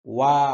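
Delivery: ওয়া with the lips rounded first, then released. Shown as a demonstration. Teacher showing lip rounding on ওয়া. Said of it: "ওয়া বললে ঠোঁট গোল হবে".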